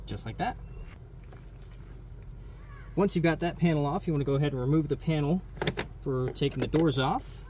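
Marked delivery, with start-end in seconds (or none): none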